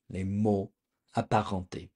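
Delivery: Les mots apparentés, French throaty r, phrase broken in two; French pronunciation. Shown as a demonstration. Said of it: "In French, it's called".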